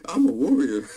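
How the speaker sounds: high leering voice, mock menacing; booty warrior impression. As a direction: play a creepy inmate making a threat. Continues the impression.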